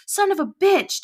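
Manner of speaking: high furious shout. Acted. Jack yelling after being stabbed, furious shout. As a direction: yell it in pain and rage. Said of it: "Jack shouted".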